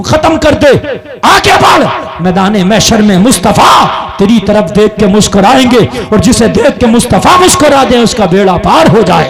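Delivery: full-force sermon cry; fiery preaching. Acted. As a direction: rouse the crowd to cheer. Preaching climax to a shout.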